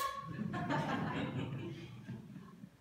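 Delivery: breathless laugh. Real, not acted. Laughing after the shock.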